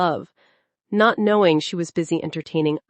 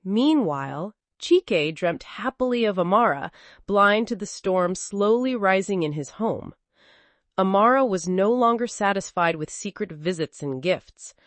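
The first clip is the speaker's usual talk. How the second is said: plain reading voice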